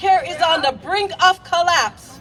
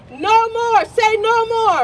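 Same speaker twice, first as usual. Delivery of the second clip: raised, high shouted chant; rally shout; 4 semitones higher than usual. Real, not acted. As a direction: shout it out to lead the crowd. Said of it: "Say it with me".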